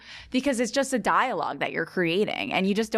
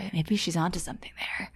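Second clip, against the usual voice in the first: mock hushed musing; 5 dB quieter than usual. Voicing onlookers quietly reconsidering.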